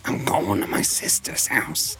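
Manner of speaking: raspy, unsympathetic cartoon wife impression; Marge Simpson. Wife leaving sad husband, mock unsympathetic. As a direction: rasp it like a fed-up cartoon wife. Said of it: "Marge having no sympathy for him".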